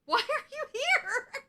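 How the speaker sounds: high squeaky voice with a laugh in it; squeaky character voice. Retold or imitated. Recapping a TV scene, squeaky mock voice of a character. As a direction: squeak out the lines like a cartoon figure.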